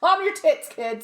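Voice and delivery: high, exasperated telling-off; a hint of an exasperated headmaster; mock headmaster. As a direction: shoo the kids away cheekily. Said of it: "The headmaster was like".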